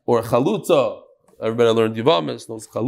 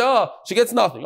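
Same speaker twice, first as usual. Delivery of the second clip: lightly acted, higher-pitched reply; unquestioning reply; 8 semitones higher than usual. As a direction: toss out a mock answer, pitched up then falling. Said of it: "Because if you just say".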